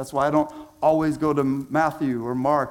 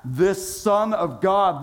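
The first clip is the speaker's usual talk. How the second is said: normal talking voice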